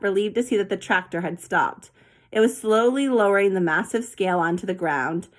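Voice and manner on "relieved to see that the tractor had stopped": ordinary delivery, nothing marked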